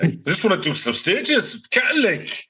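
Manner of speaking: high, fast Geordie attempt; Geordie accent. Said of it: "I want Geordie there".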